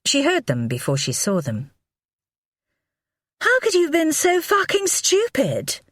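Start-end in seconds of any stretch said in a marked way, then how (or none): 3.4-5.8 high, sharp scream of rage at her husband; furious scream. A narrator's reading. Wife screams at husband over his stupidity, furious high yell. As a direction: shriek it at him, furious. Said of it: "Livy screamed".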